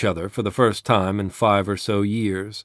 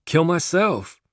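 high, falling are-you-stupid answer; scornful obviousness; 8 semitones higher than usual. A narrator's reading. Gunman to a dim questioner, scornfully obvious. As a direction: answer as if it were painfully obvious. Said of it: "as though Jesus were a moron".

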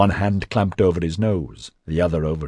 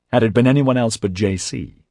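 ordinary delivery

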